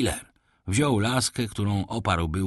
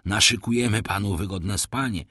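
plain reading voice

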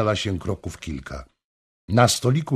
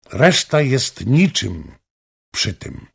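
slow, louder, joy ringing; happy voice; 3 semitones higher, 4 dB louder, slower than usual. A narrator's reading. Anna answering the king's promise, joyful. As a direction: let happiness ring in it. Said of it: "głosem, w którym brzmiało szczęście".

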